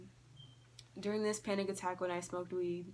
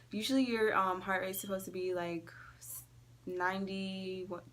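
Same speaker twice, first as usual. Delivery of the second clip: ordinary talk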